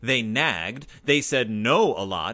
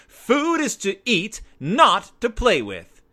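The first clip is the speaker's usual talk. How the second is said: higher, louder scolding shout; shouting mother; 10 semitones higher, 7 dB louder, slower than usual. A narrator's reading. Mama shouting at cubs playing with food, loud scold. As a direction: stop the cubs' nonsense with a shout. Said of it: "shouted Mama".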